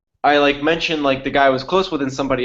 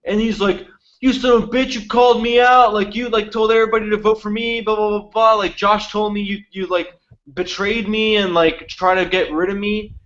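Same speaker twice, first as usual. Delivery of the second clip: higher-pitched retelling of a betrayed ally's insults; mock furious rant; 6 semitones higher than usual. Betrayed ally cursing out the narrator, retold in a higher mock-angry voice. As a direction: play the outraged friend, half-mocking.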